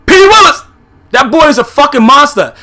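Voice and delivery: name yelled high and loud, then ranting on; exasperated shout. Real, not acted. Fan shouting about the linebacker he fears, exasperated shout. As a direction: blurt the name like it's a threat. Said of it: "I can't even get it out".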